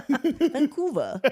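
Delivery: slow, high, hammed-up Boston "Vancouver"; a jokey Boston take on "Vancouver"; Boston accent. Shown as a demonstration. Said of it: "No, that's a Boston accent".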